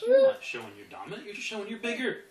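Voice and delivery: emphatic correction. Retold or imitated. Trainer telling the owner he only shows he's bigger, emphatic correction. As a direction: correct him forcefully.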